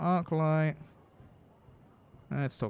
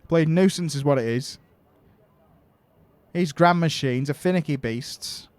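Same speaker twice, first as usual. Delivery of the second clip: loud Yorkshire grumble; Yorkshire accent; 2 semitones higher, 7 dB louder than usual. Acted. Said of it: "grumbled in a thick Yorkshire accent".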